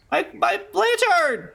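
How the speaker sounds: excited. Real, not acted. Gamer reacts to in-game item.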